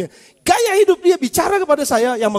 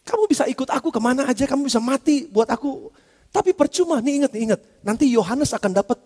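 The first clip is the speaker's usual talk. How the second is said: usual speaking voice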